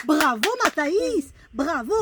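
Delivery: slow, drawn-out bravo. Real, not acted. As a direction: cheer the little one on warmly. Praising child's egg cracking.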